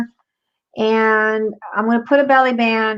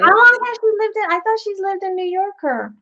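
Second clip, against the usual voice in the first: surprised high; 10 semitones higher than usual. Real, not acted. Surprised question.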